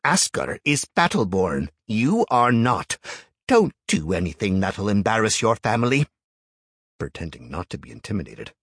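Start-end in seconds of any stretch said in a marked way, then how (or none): 0.0-6.1 stern elder. A narrator's reading. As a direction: scold him firmly. Elder scolds Jarl.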